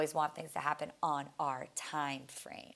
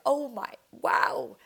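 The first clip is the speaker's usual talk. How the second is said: teary awe; 6 semitones higher, 7 dB louder, much slower than usual. Retold or imitated. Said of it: "got teary-eyed 'cause I was like".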